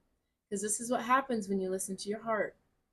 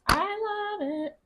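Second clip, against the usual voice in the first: delighted squeal; 12 semitones higher, 4 dB louder, slower than usual. Real, not acted. Delighted by the card reading.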